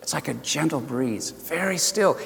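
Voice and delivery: very soft, near-whispered description; gentle hush. Shown as a demonstration. Preacher likens God's voice to a breeze, hushed. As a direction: let it float softly like a breeze. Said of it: "a whisper".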